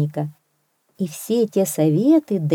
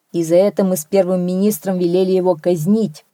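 ordinary delivery

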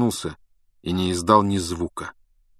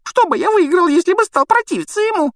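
very high, loud snivel; Pettigrew snivelling; 6 dB louder than usual. A narrator's reading. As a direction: wail it in a squeaky tearful voice. Pettigrew whining his excuses. (громкое тонкое хныканье)

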